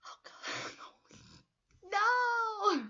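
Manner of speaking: a high, loud cry of "no" broken by laughter; yelling at the screen, half laughing in protest; laughing shout. Retold or imitated. Reacting to Helga kicking the locket.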